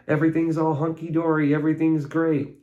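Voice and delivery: sing-song fake cheer; mock cheerful.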